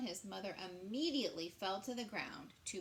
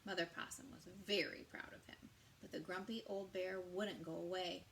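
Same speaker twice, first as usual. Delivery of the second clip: ordinary delivery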